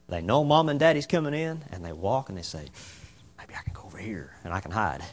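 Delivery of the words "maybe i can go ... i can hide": put-on hushed child's whisper, no voice. Child plotting to hide from Mom, whispered. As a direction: breathe it like a kid hiding.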